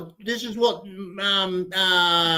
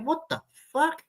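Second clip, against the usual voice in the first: higher, stunned inner voice; incredulous thought; 10 semitones higher, 4 dB quieter than usual. Retold or imitated. Traveller thinking in disbelief at customs, higher, stunned. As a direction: blurt it in disbelief, pitched up. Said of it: "you think to yourself".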